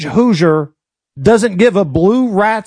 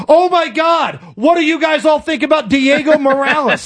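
high, hammed-up panic; mock panic; 6 semitones higher than usual. Alarmist bursting into an Indiana barbershop, hammed-up high panic. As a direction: burst in with exaggerated alarm, voice pitched way up. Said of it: "you're panicking and going".

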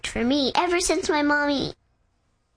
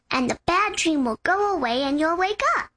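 usual speaking voice

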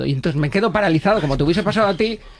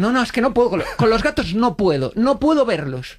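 higher, whiny refusal; the date pleading, higher; disgusted girlfriend; 6 semitones higher than usual. Retold or imitated. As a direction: insist she can't stand cats. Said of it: "y dice".

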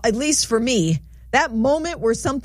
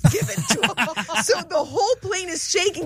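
laughing; 8 semitones higher than usual. Real, not acted. Laughing retelling sister's flight.

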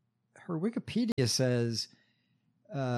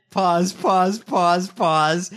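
loud, higher mock calls of pause; mock stage directions; 5 semitones higher, 8 dB louder, much slower than usual. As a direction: bark out each pause like a parody. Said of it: "mocking her pauses".

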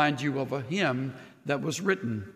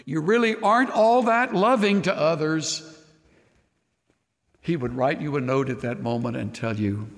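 ordinary talk, nothing marked

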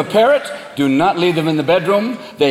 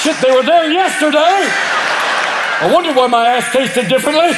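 alarmed dog; 6 semitones higher than usual. Pretend. Dog realizing its balls are gone. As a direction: blurt it out in dopey alarm.

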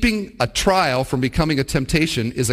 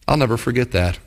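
usual speaking voice

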